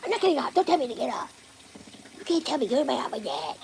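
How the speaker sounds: high, loud whiny voice for the dog; silly put-on voice. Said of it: "Why are you talking like that?".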